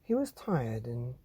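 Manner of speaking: lively bedtime story reading; animated read-aloud for children; story narration. Acted. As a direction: read it like a picture book to kids. Reads the picture book aloud.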